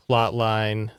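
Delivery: ordinary talk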